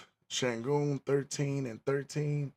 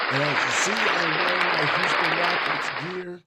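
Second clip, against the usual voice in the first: loud, higher hype for his team; hyped shout; 5 semitones higher, 7 dB louder than usual. Real, not acted. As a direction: shout it out proud of the Rockets gear. Hyping team gear to audience.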